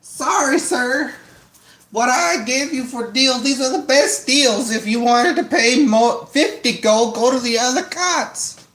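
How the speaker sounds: high twangy hillbilly cart driver; pushy country salesman twang. Acted. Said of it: "You like my hillbilly voice?".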